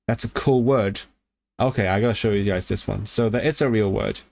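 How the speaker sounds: ordinary delivery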